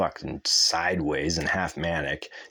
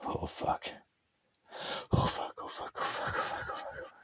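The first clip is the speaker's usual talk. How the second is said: whispered, repeated mock panic, much softer; panicked whisper; 9 dB quieter than usual. Mocking the scared guy who left, whispered panic. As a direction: hiss the curses in fake dread.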